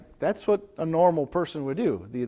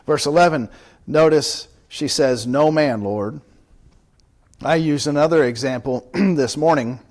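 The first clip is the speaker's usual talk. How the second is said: much louder, driving sermon delivery; full-force preaching; 12 dB louder than usual. Real, not acted. Pastor preaching loudly to his congregation about Jesus.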